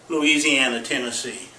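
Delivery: ordinary talk, nothing marked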